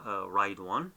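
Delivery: normal talking voice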